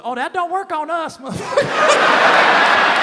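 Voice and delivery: very high, slow, laughing woman's voice; high mock woman. Nanny answering Gary, high laughing put-on voice. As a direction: squeal it out laughing as her.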